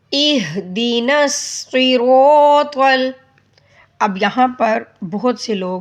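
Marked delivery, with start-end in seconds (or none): 0.1-3.1 slow chant, pressing the ya of وإياك; recite, leaning on the shadda; stressed recitation. Shown as a demonstration. Teacher reciting with the stressed ya.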